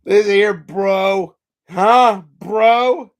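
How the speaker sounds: loud, challenging bro taunt; in-your-face shouting; confrontational. Real, not acted.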